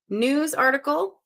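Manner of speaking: puzzling over "News article" slowly; slow questioning reread. Shown as a demonstration. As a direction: draw out "News article" and lift the end. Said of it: "repeats clue, slower, rising".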